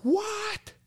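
very high thin mock yell; imitating neighbor's scream. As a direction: squeal the neighbor's shout from far off. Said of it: "I just hear".

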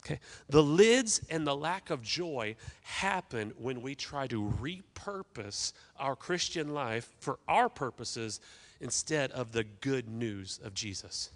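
normal talking voice

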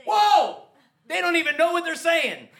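high, loud whoa of astonishment; amazed reaction. Retold or imitated. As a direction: re-enact being floored by a discovery. Acts out own amazed reaction.

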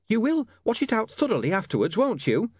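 high, nagging landlady voice; a prim landlady nagging at his back; shrill nagging landlady. A narrator's reading.

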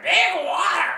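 very high squawk lifting at the end; squawky bird voice, rising. Retold or imitated. Podcaster repeating the gull's cry, very high and rising. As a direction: call it out again like a gull. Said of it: "He goes".

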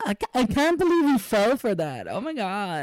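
high, hammed-up fake shock; overacting like a staged video; mock actress. Mocking a staged couple video with high fake shock.

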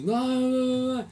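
mocking whiny refusal. Player refusing in retold quarrel.

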